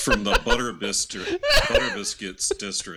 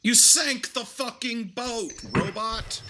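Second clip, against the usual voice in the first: angry yell; 12 semitones higher, slower than usual. Acted. Player yells at robot.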